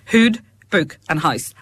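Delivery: imitated Fermanagh vowels; Fermanagh accent. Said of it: "mick out of you about your accent".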